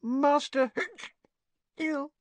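high, slow, slurred elf with hiccups; drunk hiccuping elf. Acted. Tipsy Winky answers Harry, hiccuping slowly. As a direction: hiccup through the words, drunk.

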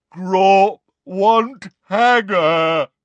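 very slow loud giant voice; slow booming giant. Acted. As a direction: boom it slowly like a giant. Giant demands.

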